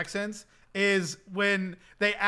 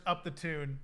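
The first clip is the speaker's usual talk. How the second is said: put-on muttered-to-self British accent, softer; imitated British accent; 3 semitones lower, 9 dB quieter than usual. Searching for the clip of the street interview, light imitated British accent. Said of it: "And she's like".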